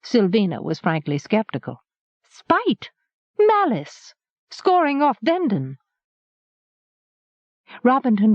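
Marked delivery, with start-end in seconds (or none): 2.3-5.8 higher, rising outburst of scorn; heated scorn. Acted. Sylvina scornfully listing Meryn's motives to the harper, heated and high. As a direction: spit out each word, higher each time.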